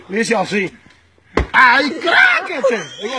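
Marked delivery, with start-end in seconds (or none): 1.5-2.8 high, slow, laughing yell; triumphant cry. Retold or imitated. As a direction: crow it out in triumph. Triumphant cry retelling the struggle. (grito triunfal y risueño, alargado)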